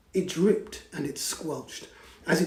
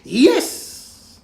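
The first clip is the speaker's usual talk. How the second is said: high, loud eager cry; witch cries yes; 11 semitones higher, 11 dB louder than usual. Acted. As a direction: shout your yes with delight. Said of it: "cried the witch".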